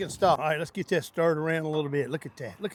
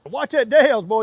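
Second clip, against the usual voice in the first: excited; 3 semitones higher, 8 dB louder than usual. Real, not acted. Catchphrase while seasoning.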